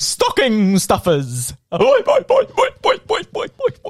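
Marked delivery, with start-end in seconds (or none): 1.7-3.9 put-on high springy boy boy chant; boing catchphrase. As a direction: bounce it like a cartoon spring.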